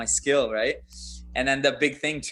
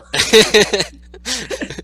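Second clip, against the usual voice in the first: loud high-pitched laugh; a big real laugh breaking out; burst of high laughter; 10 semitones higher, 10 dB louder than usual. Real, not acted. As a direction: crack up laughing hard. Laughing at his own story.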